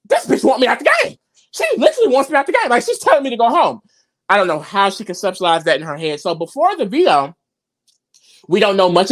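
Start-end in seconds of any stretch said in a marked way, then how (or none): 0.0-3.8 high, fast, indignant imitation; mock outrage. Host imitating Nicole feeling threatened, mock outrage. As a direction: rush it out as mock-indignant complaint.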